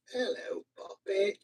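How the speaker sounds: very high, soft squeaky voice; high puppet greeting. Pretend. Host greeting a puppet, high little voice. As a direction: pitch it way up for a puppet.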